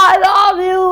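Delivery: high, loud, drawn-out yell; mountaintop shout. Shown as a demonstration. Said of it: "ચીખા ચિલ્લાવીને mountain ઉપર". (પહાડ પરથી ચીસ પાડીને બોલવું)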